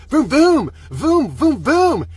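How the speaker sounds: loud, high mouth-made revs; engine revving imitation. Driver feathering the gas, imitated engine revving. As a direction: rev it in punchy bursts.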